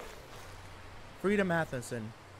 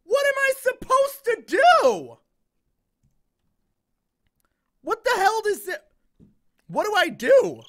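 high, loud frantic cries; panicked yelling; 14 semitones higher, 8 dB louder than usual. Real, not acted. Player hunted by the monster, yelling in panic. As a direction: lose it in real panic. Panicking under attack in game.

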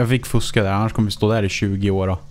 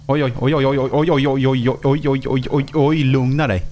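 rapid rising oj oj, higher and excited; alarmed oj oj; 4 semitones higher than usual. Real, not acted. Gamer watching his car tip over, alarmed. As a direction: panicky string of oj as the car tips.

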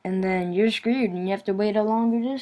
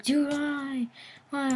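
high-pitched mock plea; imitated pleading friend; 8 semitones higher, 3 dB quieter, slower than usual. Friend protests his refusal to talk, high mock pleading. Acted friend whining back.

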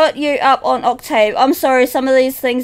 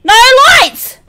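panicked cry; 8 semitones higher, 6 dB louder than usual. Real, not acted. Panic in game.

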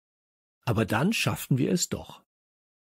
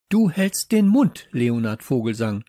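angry snapping; 6 dB louder than usual. Acted. Teacher silencing Leo mid-sentence, angry snap. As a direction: cut the pupil off angrily. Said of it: "fuhr Popo ihn an".

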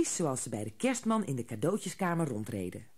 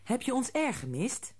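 put-on higher curious little girl; curious child; 9 semitones higher than usual. A narrator's reading. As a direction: ask eagerly as a child. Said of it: "wilde Haasje weten". (nieuwsgierig kind, hoger)

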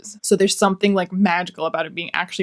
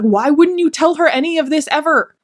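exasperated question; 8 semitones higher, 4 dB louder than usual. Real, not acted. Exasperated reviewer's rhetorical question.

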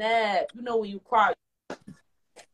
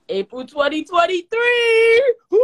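whooping excited cheer; hype shout; 10 semitones higher, 6 dB louder than usual. Real, not acted. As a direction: yell it out like a big announcement. Celebrating future plans.

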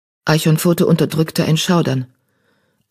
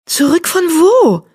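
high, slow puzzled mew; a much higher young tomcat asking; young cat mewing; 14 semitones higher, 4 dB louder, slower than usual. A narrator's reading. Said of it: "miaute Weidenpfote". (hohes, langsames Miauen)

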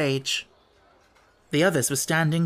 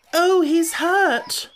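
much higher, very slow anguished cry; shrieking; 5 dB louder, much slower than usual. A narrator's reading. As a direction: shriek for the hurt baby. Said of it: "shrieked Anthea".

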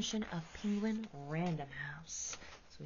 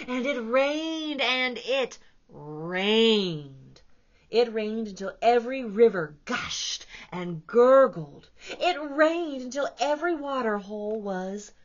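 much louder swelling narration; dramatic read; 4 semitones higher, 14 dB louder, slower than usual. A narrator's reading. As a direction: let the storm pour. Dramatic storybook reading.

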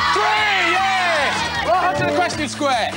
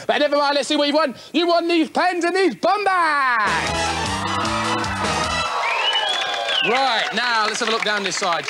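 game show host; 7 semitones lower than usual. Acted. Host explains the course rules.

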